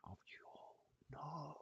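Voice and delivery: shocked whisper. Real, not acted. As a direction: gasp it out quietly. Shocked at total.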